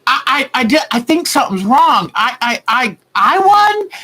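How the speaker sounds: high, breathless stammering joy; giddy stunned winner; flustered disbelief. Retold or imitated. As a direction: stammer it out, can't believe you won. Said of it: "she got all flustered".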